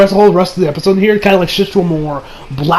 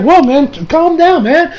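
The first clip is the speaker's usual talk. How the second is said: soothing Josuke; 9 semitones higher than usual. Retold or imitated. Josuke calms the panicking man.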